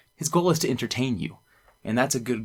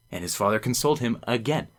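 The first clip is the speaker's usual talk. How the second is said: ordinary talk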